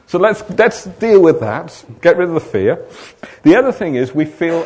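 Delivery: loud, driving sermon voice; preaching at full force. Acted. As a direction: preach it hard to the room. Preaching to the congregation.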